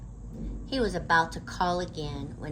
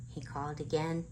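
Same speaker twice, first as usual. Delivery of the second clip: ordinary delivery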